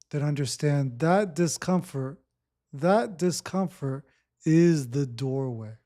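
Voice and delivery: ordinary talk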